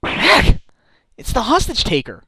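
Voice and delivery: high, loud startled cry; startled shout. Acted. Nick startled by the hostage taker's voice, high loud shout. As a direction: jump out of your skin.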